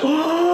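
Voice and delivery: a sudden high gasp of amazement; sharp surprised gasp. Retold or imitated. Gasping at the movie's karate reveal. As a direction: gasp like a kid seeing the big reveal. Reacting to the blocked punch.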